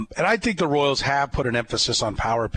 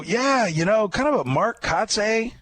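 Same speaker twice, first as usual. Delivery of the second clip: mock scout voice; 9 semitones higher than usual. Host retelling the scout shrugging off the comparison, mock-casual. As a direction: lightly imitate the scout shrugging it off. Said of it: "they come back and they go".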